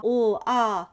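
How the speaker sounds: "ul", "ah" as two separate letter sounds; teacher sounding out "ul, ah". Shown as a demonstration. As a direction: voice each sound apart, "ul" then "ah". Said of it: "blend the L and the O".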